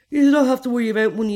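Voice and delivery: fast, loud, persuasive; pushy coaxing. Retold or imitated. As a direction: talk her into it.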